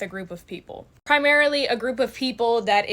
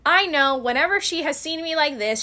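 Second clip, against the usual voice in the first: normal talking voice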